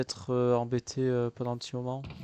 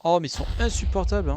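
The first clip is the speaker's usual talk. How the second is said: loud exasperated groan; fed up, complaining loudly; 6 semitones higher, 6 dB louder than usual. Real, not acted. Exaspéré par les creepers. (exaspéré et fort)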